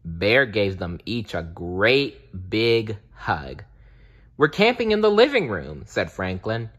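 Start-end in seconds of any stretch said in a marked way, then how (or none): 4.4-5.8 put-on high, fast, eager child; excited. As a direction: burst out with excitement. Excited Franklin.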